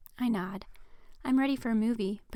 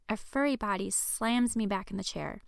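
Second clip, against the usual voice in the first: ordinary delivery, nothing marked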